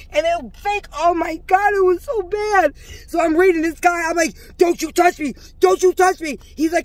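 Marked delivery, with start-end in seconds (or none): 4.6-6.4 retold sharp warning, a little higher; indignant warning. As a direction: warn someone off, mildly heated. Retold confrontation, warning him off.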